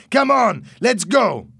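loud, high, impatient yell; shouting. A narrator's reading. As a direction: urge them on at full voice. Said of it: "Louis shouted".

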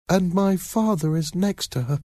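put-on high, timid child's voice; frightened little boy. A narrator's reading. Boy answers the man about his mother, timid. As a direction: answer as a scared small boy, high-pitched.

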